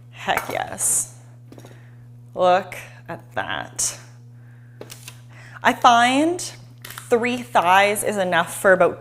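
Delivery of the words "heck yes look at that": breathy hushed whisper of delight; barely voiced, savoring it; whispered heck yes. Real, not acted. Cook whispering to viewers over the cutting board, hushed delight.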